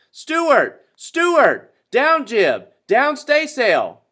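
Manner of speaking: very high, loud commands, slowing at the end; yelling directions. A narrator's reading. Owner yelling orders to Stewart, very high loud commands. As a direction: bellow orders across the pond. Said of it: "yelling directions".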